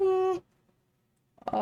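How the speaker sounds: yawned out, drawn and high; yawning. Real, not acted. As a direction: say it through a yawn. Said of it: "I yawned".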